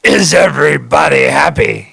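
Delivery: lightly imitated old record voice shouting to the crowd; [name] on the record. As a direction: call it out like the vaudeville singer at the song's end. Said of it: "it goes".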